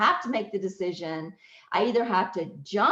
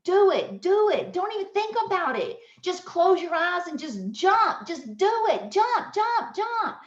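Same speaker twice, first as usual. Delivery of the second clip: pep talk; 10 semitones higher than usual. Retold or imitated. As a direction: imitate urgent onlookers, pitched way up. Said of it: "they start giving me a pep talk".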